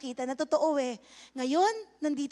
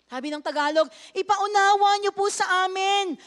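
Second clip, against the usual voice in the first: higher, louder impassioned sermon, slow on key words; full-force preaching; 4 semitones higher, 7 dB louder, slower than usual. Acted. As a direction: preach it hard to the congregation.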